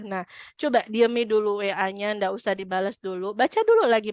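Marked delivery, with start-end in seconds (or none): none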